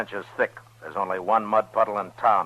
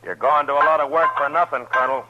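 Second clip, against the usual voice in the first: calling out; 8 semitones higher, 6 dB louder than usual. Acted. Calling out to hidden man.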